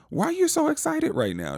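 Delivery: very high-pitched teasing question; mock incredulity. Teasing the mother who's thrilled, incredulous. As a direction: ask it high, playfully baffled.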